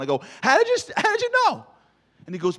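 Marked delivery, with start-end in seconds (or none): 0.4-1.7 much higher, louder stunned question; amazed. Retold or imitated. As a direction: gasp it out in disbelief at the doctor. Amazed at pediatrician.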